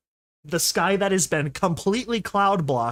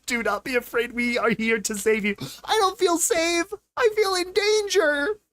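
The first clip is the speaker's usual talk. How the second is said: much higher, hammed-up frightened voice; mock fear; 10 semitones higher than usual. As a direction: play the scared pony. Mocking the ominous promise.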